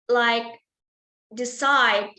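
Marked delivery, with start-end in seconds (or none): 1.3-2.1 "decide" with its last syllable punched and falling; stress on last syllable. Shown as a demonstration. Presenter giving an example of last-syllable stress, "decide" stressed at the end. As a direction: hit the second syllable of "decide" hard. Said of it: "stresses on last syllable".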